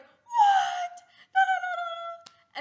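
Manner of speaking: very high, loud shriek; mock shout. Friend shrieking the secret aloud, hammed-up shout. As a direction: blurt it out at the top of your lungs. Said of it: "they shout whatever you just told them".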